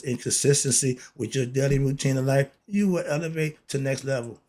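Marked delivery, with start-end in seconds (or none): none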